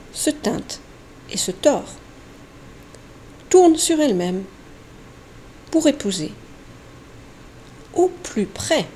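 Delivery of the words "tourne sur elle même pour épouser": ordinary delivery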